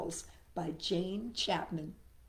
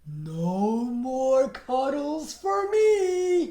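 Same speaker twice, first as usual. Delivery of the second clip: slow, pleased and a little louder; grinning; 5 dB louder, much slower than usual. A narrator's reading. Barry grinning to himself in the mud, pleased and slow. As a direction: savor it with a grin. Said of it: "he grinned".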